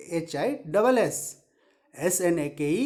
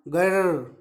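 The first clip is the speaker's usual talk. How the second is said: long rolled-r grrr; teacher's demonstration growl; tiger growl; 3 semitones lower, much slower than usual. Shown as a demonstration. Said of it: "tiger sound".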